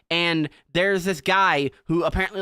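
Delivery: ordinary talk, nothing marked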